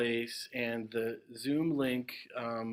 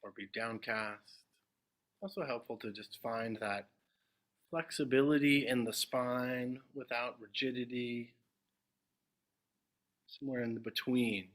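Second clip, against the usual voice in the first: unhurried meditation guidance with long silences; guided meditation. Acted. Teacher guiding the group into meditation, spacious and measured. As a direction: settle listeners gently, phrase by phrase, leaving long pauses.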